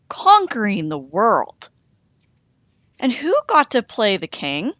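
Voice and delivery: ordinary delivery, nothing marked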